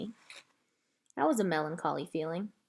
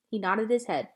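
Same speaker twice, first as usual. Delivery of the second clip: usual speaking voice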